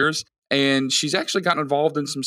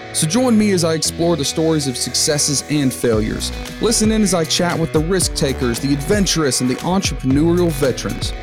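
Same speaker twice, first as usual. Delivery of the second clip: bright, louder podcast intro over music; upbeat travel show host; 2 semitones higher, 3 dB louder than usual. Acted. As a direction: pump up the listener like a show opener.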